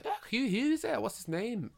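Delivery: high mock Scottish accent; chirpy put-on Scottish asking who. Merida quizzing Mulan about her date in a high mock Scottish accent. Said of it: "I've worked on that accent for a while".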